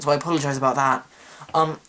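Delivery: usual speaking voice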